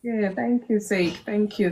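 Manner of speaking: ordinary talk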